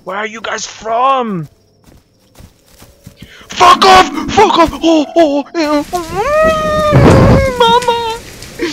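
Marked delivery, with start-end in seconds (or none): 3.5-4.7 very high, very loud screamed curse; terrified scream. Real, not acted. Monster attacks, screams at it. 4.7-8.7 very high, loud wailing cry for mama; real terror spilling out as a wail; terrified wail. Real, not acted. Terrified wailing during attack.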